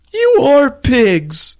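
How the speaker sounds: put-on high, loud, very slow kid wail; hammed-up kid fury; mock tantrum. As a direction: squeal each word separately in outrage. Acting Melvin's tantrum at parents.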